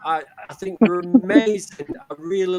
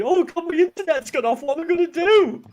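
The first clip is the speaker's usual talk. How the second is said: panicked; 10 semitones higher, 4 dB louder than usual. Retold or imitated. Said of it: "texting Andy and saying".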